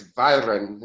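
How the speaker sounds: "Byron" said as VEE-ron, with a v at the start, slowly; Greek pronunciation. Shown as a demonstration. Showing the interviewer how Greeks say "Byron", slow and careful. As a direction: give "Byron" the Greek V sound, drawn out. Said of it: "In, in Greek".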